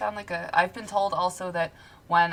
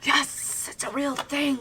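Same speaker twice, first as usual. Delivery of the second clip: excited; 8 semitones higher than usual. Real, not acted. Delighted ghost cars exist.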